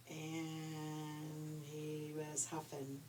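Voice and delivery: plain reading voice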